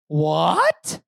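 low start, sharply rising question; incredulous one-word question; puzzled disbelief. Acted. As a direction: react as if you can't believe the order. Acted puzzled reply to the command.

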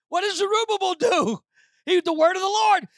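loud, high sermon delivery; preaching at full force. Real, not acted. Preacher asking what Zerubbabel does, full-force shout. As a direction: call it out to the whole church.

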